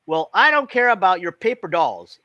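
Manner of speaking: loud, sulky retort; snapping back. A narrator's reading. Girl snapping back at her friend in a quarrel, loud.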